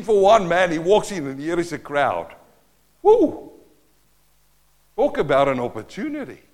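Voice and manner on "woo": a sudden high loud whoop of delight; excited whoop. Acted. Pastor picturing Peter walking into a crowd, whooping with excitement. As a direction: let out a thrilled woo.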